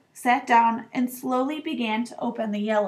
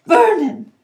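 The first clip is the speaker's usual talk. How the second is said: high, loud anxious cry; frightened wife's quavering call; quivering voice; 7 semitones higher, 10 dB louder than usual. A narrator's reading. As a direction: call your husband in alarm. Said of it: "in a quivering voice".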